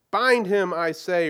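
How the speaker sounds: high, loud cold command; commanding witch. A narrator's reading. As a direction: bark the order like a queen.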